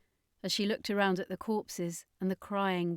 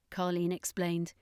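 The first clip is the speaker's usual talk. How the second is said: plain reading voice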